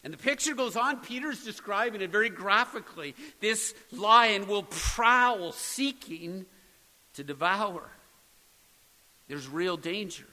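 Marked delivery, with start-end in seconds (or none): 3.4-7.9 slow prowl. Acted. Graphic picture of prowling lion.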